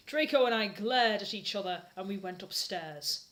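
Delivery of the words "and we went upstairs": ordinary talk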